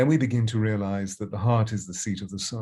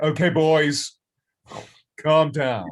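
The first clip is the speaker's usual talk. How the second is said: louder, higher, firm command to rowdy men; firm telling-off; 7 semitones higher, 8 dB louder, slower than usual. Retold or imitated. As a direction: settle the boys down with authority. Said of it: "she would just simply say".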